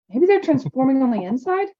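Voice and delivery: put-on a touch of a hopeful boy. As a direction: wish it's true. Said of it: "Zane said hopefully".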